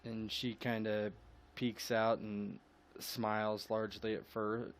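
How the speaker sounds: normal talking voice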